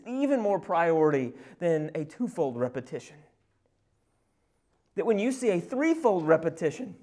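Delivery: loud, rising preaching climax; preaching at full force. Acted. Preacher stressing the utmost importance to the congregation, preaching at full force. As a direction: build it up and drive it home.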